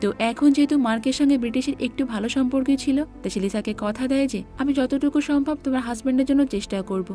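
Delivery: plain reading voice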